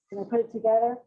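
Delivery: usual speaking voice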